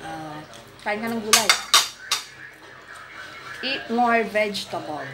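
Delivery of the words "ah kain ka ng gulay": normal talking voice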